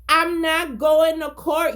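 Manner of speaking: slow, loud and high refusal; defiant shout. Retold or imitated. Man refusing court to the officer, slow loud defiant shout. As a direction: plant your feet and shout it out.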